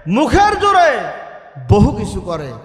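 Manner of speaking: ordinary delivery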